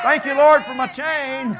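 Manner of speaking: high, very loud holler of thanks; acted-out jubilant prayer at full force; exultant shout. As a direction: shout it joyfully up to God. Acting out Casey's thanks, story climax.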